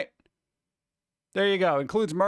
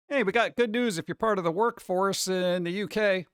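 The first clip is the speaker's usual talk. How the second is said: ordinary delivery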